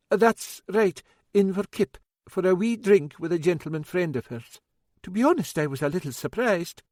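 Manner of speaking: fussing, a wee bit worried cook; older woman, worried. A narrator's reading.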